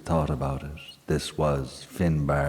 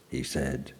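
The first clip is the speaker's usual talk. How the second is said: plain reading voice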